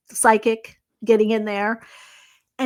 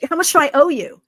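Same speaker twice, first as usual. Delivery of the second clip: louder, higher repeated question; imitated insistence; 6 semitones higher, 6 dB louder, faster than usual. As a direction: press the question as if it were obvious. Client insists after "Owe me?.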